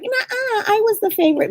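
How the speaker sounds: high, childish-style "I'm the favorite" squabble imitation; bickering sibling. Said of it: "If you have a sibling that's watching or whatever, they're just like".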